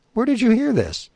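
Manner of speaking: can't believe her ears; shocked question. Acted. She-bear demanding where her mate heard this, astonished.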